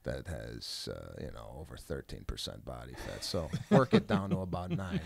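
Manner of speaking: dry sarcasm. Retold or imitated. Wife laying down terms to her gym suitor, dry and sarcastic. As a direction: imitate her very dry, sarcastic delivery, pitched well down. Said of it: "Very sarcastic, very dry".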